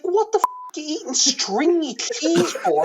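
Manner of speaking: high, slow, scornful camp voice; mock camp director outrage. Actor mimics his director scorning string cheese, high and slow outrage. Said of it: "He's like".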